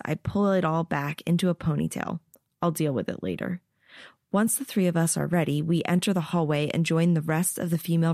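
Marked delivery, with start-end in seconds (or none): none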